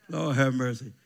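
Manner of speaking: usual speaking voice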